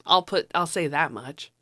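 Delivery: ordinary talk, nothing marked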